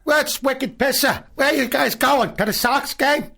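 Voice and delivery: put-on high, loud, slow thick Boston accent; Boston Southie accent. Comic doing a loud Boston Southie guy for the host. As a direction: ham up a Southie guy greeting his buddies. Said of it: "That's a good Southie accent".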